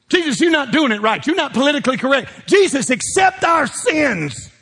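high, demanding mock complaint; mocking world. The world complains to Jesus, mocking and demanding.

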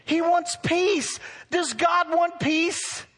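high, loud and slow call to the congregation; preacher drawing out a loud answer; rallying call. Shown as a demonstration. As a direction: lead the church in a slow, loud response. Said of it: "Let's all say it".

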